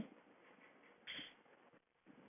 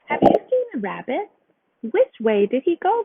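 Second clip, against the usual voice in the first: louder questioning fox voice; sly fox; 8 semitones higher, 3 dB louder than usual. A narrator's reading. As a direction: demand where the rabbit went.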